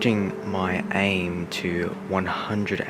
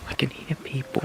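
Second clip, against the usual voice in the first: tense hushed whisper, pitched up; scared whisper; 6 semitones higher, 5 dB quieter than usual. Real, not acted. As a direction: breathe it out, unnerved. Hearing voices, frightened.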